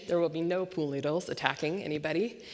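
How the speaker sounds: ordinary delivery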